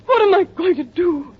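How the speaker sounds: frantic weeping woman asking for help; distraught crying. Acted. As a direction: sob it out in despair.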